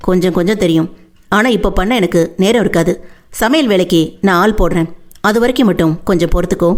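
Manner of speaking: Veerapandi, practical. Acted. Veerapandi promises Vennila a hired cook, low practical voice.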